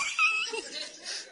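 very high, sudden cackling laugh; real burst of laughter. Real, not acted. Preacher amused by a cheeky Twitter reply, bursting out laughing.

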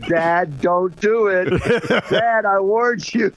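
lightly voiced teenager, rising in pitch; mock pleading daughter. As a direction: warn Dad off, drawing out the last threat. Imitates daughter warning embarrassing dad.

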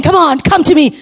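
high, loud, eager call; Jesus, urgently inviting. Acted. Preacher voicing Jesus calling people to him, urgent high invitation. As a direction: urge them to come with excitement. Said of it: "Jesus is saying".

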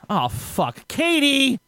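mock calling out for someone upstairs; imitated exasperated call. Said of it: "because he goes".

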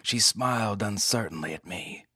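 usual speaking voice

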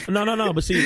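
high, loud protest through laughter; laughing protest. Real, not acted. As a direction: laugh out loud as you wave it off. Host laughing off the remark.

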